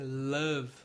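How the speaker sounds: "Love" said "lav", the final E silent. Shown as a demonstration. Teacher repeating the right "Love" for the class. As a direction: drop the E at the end of "Love". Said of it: "o E do final quase não é pronunciado".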